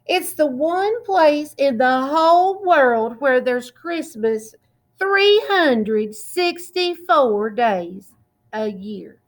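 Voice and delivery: plain reading voice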